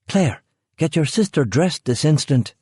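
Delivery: put-on high sharp order to a child; stern mother. A narrator's reading. Rachel ordering Claire to dress her sister, stern high. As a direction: snap a command at your daughter.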